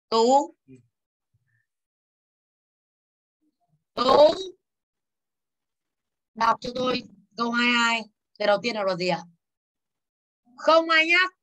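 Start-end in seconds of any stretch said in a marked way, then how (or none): 0.1-4.3 calling out. Real, not acted. Teacher calling a student. 10.6-11.3 stern warning. Real, not acted. Teacher scolding the class.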